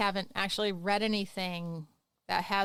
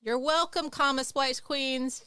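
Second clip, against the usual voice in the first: sassy; 5 semitones higher, 5 dB louder, slower than usual. Acted. Sassy sign-off to comma splicers.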